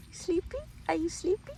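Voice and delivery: high sing-song voice to a pet; high cooing question to the dog. Real, not acted. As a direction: coo at the sleepy dog. Baby talk to the dog.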